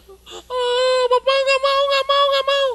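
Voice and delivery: put-on high, loud wailing child who refuses; crying child. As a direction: sob out the refusal like a scared boy. (anak menangis ketakutan, suara tinggi)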